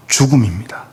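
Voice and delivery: whispered, grave; hushed warning. Acted. Sermon warning of death. (속삭이듯 엄숙하게)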